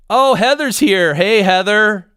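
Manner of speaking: delighted live-stream greeting; excited greeting. Real, not acted. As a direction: greet her happily, pitch up. Greets viewer arriving in chat.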